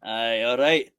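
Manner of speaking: low Scottish "aye, all right"; gruffly agreeing, sliding into Scottish; accidental Scottish. Acted. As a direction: slip into a Scottish accent. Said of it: "I don't know why I've turned Scottish".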